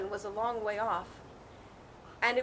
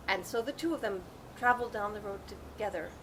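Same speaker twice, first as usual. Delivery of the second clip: ordinary delivery, nothing marked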